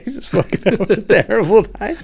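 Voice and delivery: high, loud words through real laughter; cracking up. Real, not acted. Comic retells a friend's bad trip, cracking up. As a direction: laugh helplessly while telling it.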